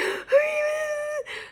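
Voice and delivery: high, wailing gasp mid-sob; sobbing gasp. Retold or imitated. Retelling her cry in the hallway, sobbing gasp. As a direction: gasp for air through tears.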